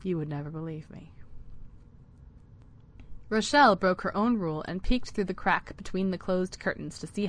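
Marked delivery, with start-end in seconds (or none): 0.0-1.1 soft murmur under the breath; murmuring to himself. A narrator's reading. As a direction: say it quietly to yourself. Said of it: "he murmured to himself".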